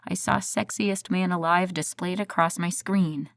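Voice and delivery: plain reading voice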